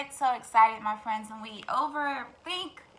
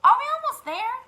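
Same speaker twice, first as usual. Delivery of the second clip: high, loud hen question; tired hen; 13 semitones higher, 8 dB louder than usual. Acted. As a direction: hen pestering the guide. Henny Penny asks fox.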